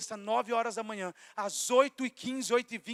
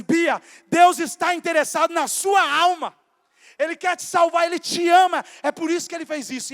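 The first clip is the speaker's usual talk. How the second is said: fervent preaching; 3 semitones higher, 8 dB louder than usual. Retold or imitated. Retold preaching to crying coworker.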